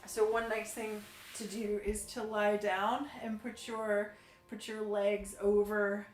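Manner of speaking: soft, soothing class guidance; calm yoga instruction. Acted. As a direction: guide gently into stretches. Leads a gentle yoga session.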